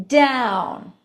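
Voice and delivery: "down" falling firmly in pitch; downward inflection. Shown as a demonstration. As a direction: let "down" drop like a statement. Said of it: "the ends of phrases go down".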